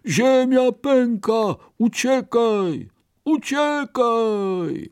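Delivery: high, drawn-out cries of alarm; rabbits calling out. A narrator's reading. Scared rabbits call back to the bear, crying out. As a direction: stretch the high warning cries. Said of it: "zawołały".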